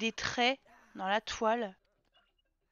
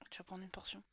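soft unvoiced whisper; quiet whisper to the sim; whispered aside; 6 semitones lower, 25 dB quieter than usual. Real, not acted. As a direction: murmur it like a secret. Quietly directing her sim.

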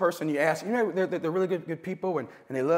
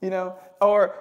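usual speaking voice

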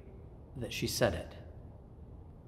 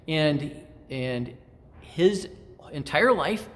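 usual speaking voice

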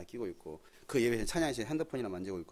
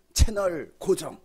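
drawn-out catchphrase; 8 semitones higher, 9 dB louder, much slower than usual. Real, not acted. Sermon punchline, TV catchphrase.

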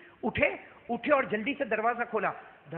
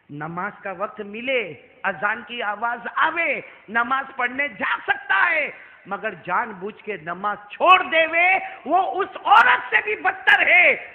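loud, slow, emphatic sermon; fiery preaching; 4 semitones higher, 10 dB louder, slower than usual. Acted. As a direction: drive home the warning to the crowd. Preaching at full force. (ज़ोरदार तक़रीर, धीमे और ऊँचे सुर में)

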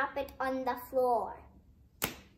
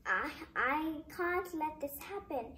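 plain reading voice

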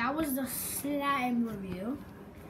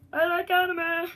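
higher, louder cartoon kid voice; perky pitched-up character line; Fishy Boopkins impression; 5 semitones higher, 7 dB louder than usual. Kid vlogger still in his Fishy Boopkins voice. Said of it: "impression of Fishy Boopkins".

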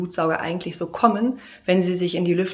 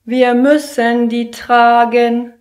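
put-on slow, loud, higher kids' chant; children in chorus; 6 semitones higher, 11 dB louder, much slower than usual. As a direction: drone it like a class of kids. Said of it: "sagen alle im Chor". (langsamer Kinderchor)

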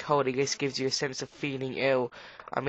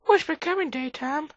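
delighted squeal; 13 semitones higher than usual. Acted. Delighted at daybreak.

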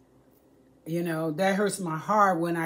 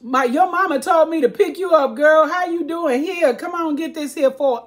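higher, louder fake friendly man picking up Crystal; predator voice; 7 semitones higher, 4 dB louder than usual. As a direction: sweet-talk the child into the car. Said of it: "he'll be like".

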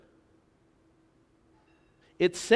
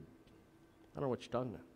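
softer, mumbled street slang pronunciation; slurred locker-room speech; 3 semitones lower, 12 dB quieter than usual. Retold or imitated. Young player answering his advisor, mumbled and much softer. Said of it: "I said".

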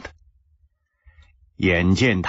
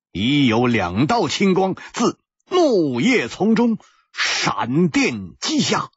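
ordinary delivery, nothing marked

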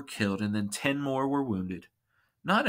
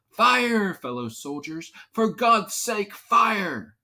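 high, loud battle cry, dipping between bursts; urgent shout; 8 semitones higher, 5 dB louder, slower than usual. A narrator's reading. American commander ordering his soldiers to fire, shouted. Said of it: "shouted the Americans' commander".

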